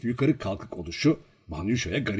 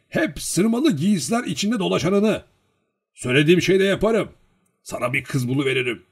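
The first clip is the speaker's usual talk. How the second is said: put-on higher, louder, boastful old matchmaker; eager old villager; 7 semitones higher, 6 dB louder than usual. A narrator's reading. Old man promising Olenin a finer girl, eager boasting.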